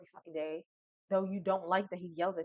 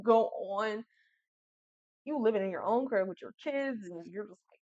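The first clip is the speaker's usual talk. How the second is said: ordinary talk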